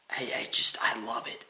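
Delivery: breathy whispered admission; whispering. Real, not acted. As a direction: whisper it to yourself. Whispered, then said aloud.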